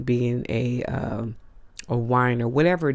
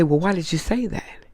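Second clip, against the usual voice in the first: confronting question; 6 semitones higher than usual. Retold or imitated. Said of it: "and say".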